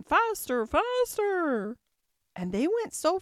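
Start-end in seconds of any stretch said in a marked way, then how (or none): 0.0-1.8 high, loud urging cry. A narrator's reading. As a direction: call out high, drawing each word out. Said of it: "cried the queen".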